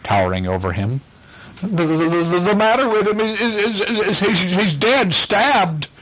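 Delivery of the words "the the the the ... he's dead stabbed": high, shaken stuttering report; panicked stammer. A narrator's reading. Pirate reporting a death to Hook, panicked stammering. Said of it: "replied Silco in a hollow voice".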